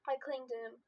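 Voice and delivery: ordinary delivery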